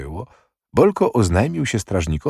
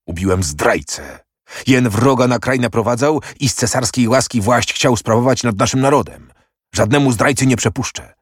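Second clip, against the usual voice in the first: heated, rising into higher-pitched defiance; forceful, raised-voice declaration; angry outburst; 4 semitones higher, 3 dB louder than usual. A narrator's reading. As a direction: burst out in indignant self-justification. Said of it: "Wybuchnął".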